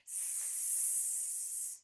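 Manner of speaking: long voiceless sss; snake hiss. Pretend. Teacher being the snake that is back, long soft hiss.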